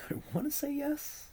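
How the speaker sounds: high, soft teasing echo; mocking echo. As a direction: parrot it back mockingly. Teasing echo of co-host's answer.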